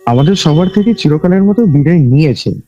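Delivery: plain reading voice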